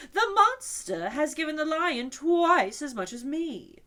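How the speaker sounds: loud, high, slow complaint; sulky outrage; indignant unicorn. A narrator's reading.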